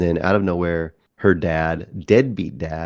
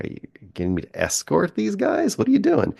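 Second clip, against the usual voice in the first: high, incredulous imitation of the daughter; mock suspicious daughter. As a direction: pitch it way up, half mocking her disbelief. Daughter voiced, suspicious of dad.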